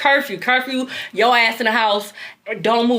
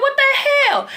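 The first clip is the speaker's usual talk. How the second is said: much higher disbelieving cry; shocked at seeing the couple, much higher. Retold or imitated. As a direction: blurt it out in shock.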